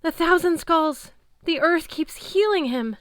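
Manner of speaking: high, loud, slow frantic cry; terrified ranting; raving terror. A narrator's reading. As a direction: rave like a madman. Chris raving in madness.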